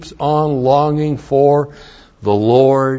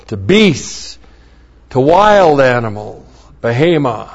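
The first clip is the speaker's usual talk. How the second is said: usual speaking voice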